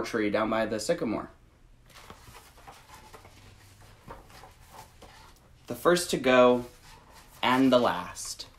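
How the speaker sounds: gentle, measured picture-book read; reading to children. Acted. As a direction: read it slowly to little listeners. Reads picture book aloud.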